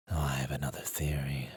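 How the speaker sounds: quick conspiratorial whisper; sly whisper. Acted. Demon confiding a new theory to her partner, whispered. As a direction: let them in on a secret.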